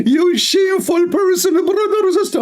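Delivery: high, put-on falsetto woman mimicking the clip; mock female voice. Mimicking the preacher in a high, mocking woman's voice. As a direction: squeak it out like a mocking lady. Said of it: "I'm trying to make his voice to speak like a female".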